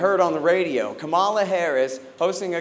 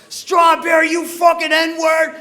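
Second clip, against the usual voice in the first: enraged scream at the radio, much higher and louder; furious shout; 10 semitones higher, 8 dB louder, slower than usual. Retold or imitated. Cop yelling at the radio in a furious scream. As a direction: bellow it like a crazed cop. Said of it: "he freaks out".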